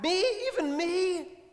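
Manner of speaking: high, incredulous; can it really be me; astonished disbelief. A narrator's reading. Woman shooting back at Jesus in disbelief. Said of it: "shot back".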